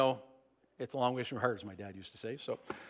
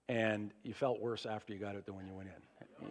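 usual speaking voice

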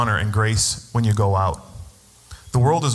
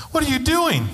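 retold high-pitched, choked-up protest; high, overcome "why would you" reaction; trying not to cry; 13 semitones higher than usual. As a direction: squeak it out as if fighting back tears. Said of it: "I'm, like, trying not to cry".

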